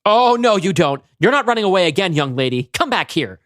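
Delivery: loud high stern yelling; angry mother. Acted. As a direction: yell after a runaway child. Mother stops runaway daughter.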